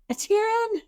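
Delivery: high-pitched call out to a companion; yelled name. Acted. Player's character calls to fallen companion Etienne, yelling.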